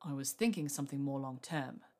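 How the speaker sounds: low-pitched suitor's growl; deep, understated growl. A narrator's reading. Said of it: "he growled".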